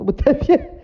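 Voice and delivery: high, laughing what the f-; laughing disbelief. Retold or imitated. Laughing at his buddy's praise. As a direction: laugh in disbelief mid-curse.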